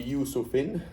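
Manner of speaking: "Yusufin" ending in a sounded -in; tanween ending. Shown as a demonstration. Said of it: "you can't have a tanween".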